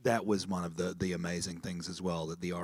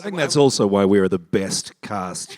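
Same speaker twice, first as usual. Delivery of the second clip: brash and loud, teasing the room; loud joking boast; 14 dB louder than usual. Real, not acted. Cast member brags to the audience, loud and joking. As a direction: crow it out loud for a laugh.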